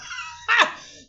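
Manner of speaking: high, loud breathy burst of laughter; a big shrieking laugh. Real, not acted. Laughs after panic outburst.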